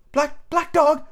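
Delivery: put-on a boy half afraid to say it; high lad's voice asking; young Jim asking.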